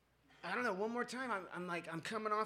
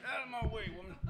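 put-on drunken bluster; 3 semitones higher, faster than usual. Drunk son-in-law shoving past the girlfriend's mom, mock bluster. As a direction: push past her with hammed-up bravado. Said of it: "I just get".

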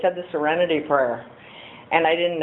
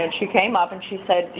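ordinary delivery

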